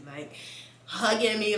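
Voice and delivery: normal talking voice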